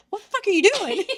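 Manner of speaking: high-pitched mock alarm; retold startled outburst. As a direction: squeal it as if jolted awake, close to laughing. Retold startled mother, laughing.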